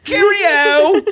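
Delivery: high, hammed-up British cry of "Cheerio!"; mock British cheerio. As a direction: toss it off like a cartoon Englishman. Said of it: "I feel like you're doing old English".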